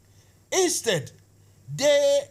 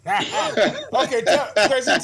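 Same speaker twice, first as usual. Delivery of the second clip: laughing through the words, amused; 6 dB louder, slower than usual. Real, not acted. Host laughs as he presses the guest to talk. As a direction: chuckle while pressing him to answer.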